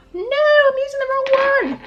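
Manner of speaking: loud, high-pitched cry of dismay; horrified yelp, pitch leaping up; alarmed outcry. Real, not acted. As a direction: suddenly shriek at your own mistake. Realises she grabbed wrong product.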